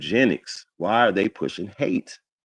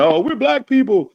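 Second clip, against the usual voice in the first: mocking quote; 13 semitones higher, 4 dB louder than usual. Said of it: "'cause they're saying".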